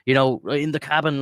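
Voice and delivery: high-pitched mock amazement; acted giddy outburst, pitched well up; going crazy with excitement. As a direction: imitate a thrilled young movie fan. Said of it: "you'd go crazy. It would be the best thing ever".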